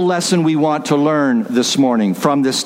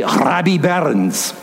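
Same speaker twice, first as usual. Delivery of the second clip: put-on slow Scottish accent with rolled R; slower than usual. Preacher quoting his father's name for the poet, Scottish accent. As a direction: say it as a Scot would. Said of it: "or as he would say".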